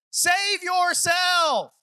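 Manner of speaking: "Save yourself" shouted high and very slow; drawn-out shout. Acted. Peter's final plea.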